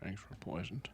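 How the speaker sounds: hushed whisper. Real, not acted. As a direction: breathe the words in a whisper. Repeats the riddle back in a whisper.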